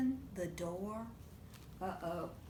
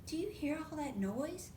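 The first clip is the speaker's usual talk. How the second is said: ordinary delivery, nothing marked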